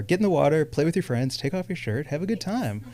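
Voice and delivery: soft, trailing-off encouragement; gentle urging. Retold or imitated. Friends at the lake urged to join in, soft coaxing. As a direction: coax quietly, letting the volume fall away.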